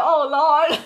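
laughing quote. Retold or imitated. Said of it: "She said".

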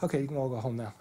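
ordinary talk, nothing marked

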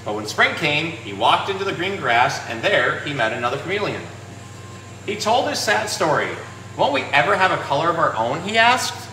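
gentle, measured picture-book narration with pauses for the pages; storybook reading. Acted. Shop owner reads to young viewers in storybook voice. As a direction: read it like story time for kids.

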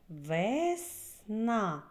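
"Весна" split slowly into Вес and на; slow, separated syllables in "Весна" for counting. Shown as a demonstration. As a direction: sound out "Весна" in two drawn-out syllables. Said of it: "Слухайте".

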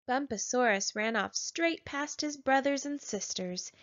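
ordinary delivery

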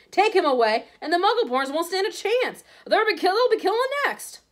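high, loud, impassioned yell; full-voiced rising alarm; yelled warning. A narrator's reading. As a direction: shout it in desperate outrage. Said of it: "yelled Hagrid".

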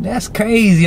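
high, wowed exclamation; retold amazement. Teen reacting to the girl's smoke, acted high-pitched wonder.

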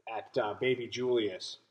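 ordinary talk, nothing marked

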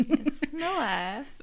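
slow, drawn-out mock Danish accent; lazy Danish accent impression. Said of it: "But in a Danish accent".

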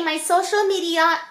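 ordinary talk, nothing marked

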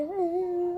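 mock crying whine, a bit high; kid's fake sob "Ee"; imitated whimper. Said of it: "Hem ağlıyor".

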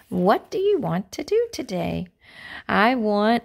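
ordinary delivery

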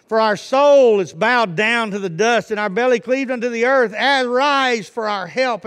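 loud, ringing Bible reading; declaimed scripture. Real, not acted. Preacher reading a plea to God aloud, fervent declaiming. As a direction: cry the verses out as a plea.

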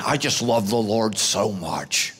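slow, low, mock-glum worshipper; joyless devotion. Glum churchgoer claiming to love God, joylessly. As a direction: drag it out low with no joy. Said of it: "Then tell your face".

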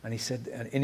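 ordinary talk, nothing marked

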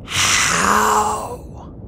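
drawn-out breathy dragon voice; quiet, creaking creature voice; creaky, eerie rasp. A narrator's reading. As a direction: rasp the word out long and rough. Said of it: "creaky and quiet and rough and eerie".

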